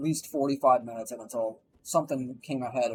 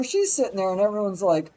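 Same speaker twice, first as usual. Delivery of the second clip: ordinary delivery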